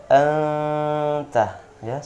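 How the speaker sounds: anta with the n held long and humming; correct nasal hold. Shown as a demonstration. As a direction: hum the n in anta for two beats. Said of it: "sekali lagi". (dengung nun dua harokat dalam anta)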